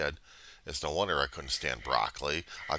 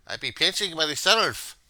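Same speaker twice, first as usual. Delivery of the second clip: put-on Irish accent; 11 semitones higher, 9 dB louder, slower than usual. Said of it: "I don't know how to do this accent".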